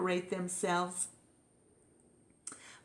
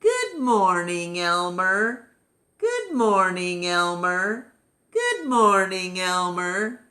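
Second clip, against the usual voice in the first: cheery greetings; 6 dB louder than usual. Acted. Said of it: "each one said to his neighbor".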